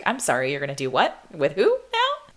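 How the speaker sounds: stunned, climbing to a squeak; incredulous shock. Retold or imitated. Shocked reply to casual date news.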